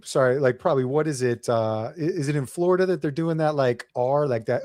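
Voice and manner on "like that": ordinary delivery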